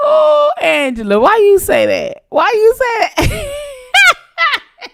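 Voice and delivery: high wailing, breaking into laughter; mock wail. Asking Angela why she said that, mock wail. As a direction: wail it high, dissolving into giggles.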